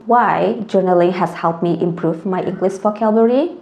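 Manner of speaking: normal talking voice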